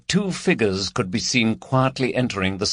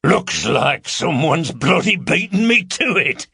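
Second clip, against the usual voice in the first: amused burly man, emphatic and raised; gruff intruder; 9 semitones higher, 4 dB louder than usual. A narrator's reading. Cartwright finding rivals in the house, amused. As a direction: boom it out at the pair you've caught. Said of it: "a deep voice laughed".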